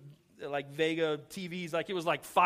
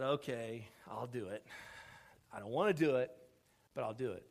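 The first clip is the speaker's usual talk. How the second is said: low, soft, reluctant; grudging consent; 5 semitones lower, 4 dB quieter than usual. Retold or imitated. Husband giving in to his wife, grudging. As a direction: give in under your breath.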